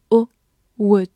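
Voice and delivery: usual speaking voice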